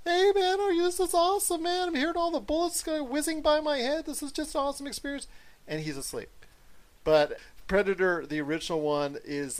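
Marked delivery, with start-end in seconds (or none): 0.0-5.3 high, excited gushing fanboy. Retold or imitated. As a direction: gush like a thrilled kid at the movies. Mocking a movie fan.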